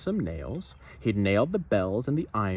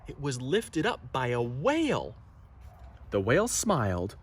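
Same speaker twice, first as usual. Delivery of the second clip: ordinary delivery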